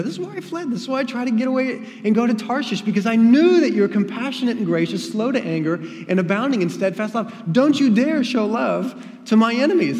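high, loud indignant outcry; angry protest. Acted. Jonah protests God's mercy to his enemies, high and indignant. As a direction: hurl the complaint at God.

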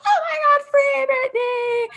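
high, loud mock scream of a crowd; imitated club scream. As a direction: squeal it out like an ecstatic club crowd. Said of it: "started, like, screaming".

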